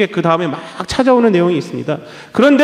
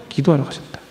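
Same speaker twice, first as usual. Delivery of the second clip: soft repeat; 8 semitones lower, 6 dB quieter than usual. Shown as a demonstration. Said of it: "한적한 곳을 기도하러 가셨다 그래요".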